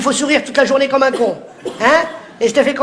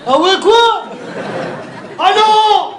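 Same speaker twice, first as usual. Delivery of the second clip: mock exchange; 9 semitones higher, much slower than usual. Acting out the street exchange.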